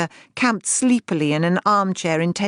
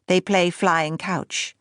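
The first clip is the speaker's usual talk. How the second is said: ordinary delivery, nothing marked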